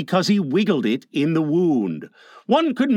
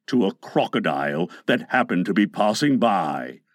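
low-voiced pirate recalling a wound; deep, with understated pain; wincing Captain Hook; 7 semitones lower than usual. A narrator's reading. Hook tells Smee about the crocodile, low and wincing.

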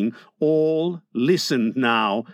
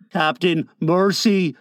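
usual speaking voice

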